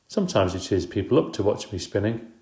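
ordinary delivery, nothing marked

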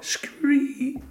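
very high frog squeal; yelled frog scree. A narrator's reading. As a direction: squeal out a drawn frog cry. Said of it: "he yelled".

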